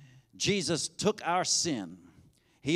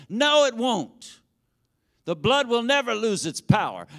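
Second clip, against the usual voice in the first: emphatic answer; 4 semitones higher, 3 dB louder than usual. Acted. Preacher answers his own question.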